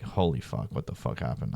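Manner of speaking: low, hushed morning-after panic; stunned whisper. Retold or imitated. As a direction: breathe it out in disbelief. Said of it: "I'm like".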